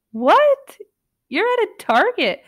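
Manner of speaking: sharp high what, then disbelief; can't believe they're in Target; baffled. Real, not acted. Reader reacting to the story, baffled and high.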